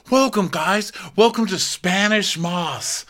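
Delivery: high slow host welcome; grand welcome. Retold or imitated.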